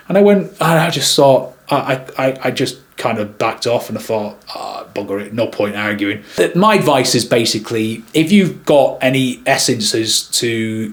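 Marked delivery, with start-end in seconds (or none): 4.6-6.2 low, soft giving-up; resigned. Retold or imitated. Videographer to himself after backing off the row, resigned. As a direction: drop the voice as if backing off. Gave up the argument.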